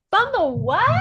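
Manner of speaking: high, incredulous echo of a strange word; baffled mock exclamation. Tourists puzzled by the Jamaican word, baffled exclamation. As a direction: act out being thrown by the word.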